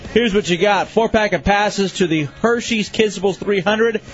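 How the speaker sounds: ordinary talk, nothing marked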